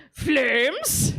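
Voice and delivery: put-on rising, questioning drunk call; drunk fan. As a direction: call out to a fellow fan, tipsy. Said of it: "he goes".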